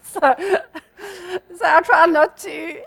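real laugh breaking into the words. Real, not acted. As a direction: talk through a laugh, slowing down. Laughs with listeners about speeding.

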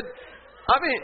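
ordinary talk

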